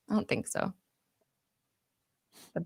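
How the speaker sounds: breathy whisper under the breath; whispered aside. Real, not acted. Friend admits it privately to her friend, whispered. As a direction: drop to a whisper.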